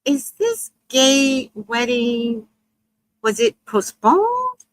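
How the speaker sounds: slow, drawn-out, climbing high at the end; tentative, stretched-out query; cautious question. Retold or imitated. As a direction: ask it slowly, piecing it together. Cautiously checking a dawning suspicion.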